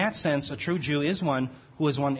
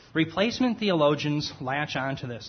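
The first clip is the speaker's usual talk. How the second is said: ordinary talk, nothing marked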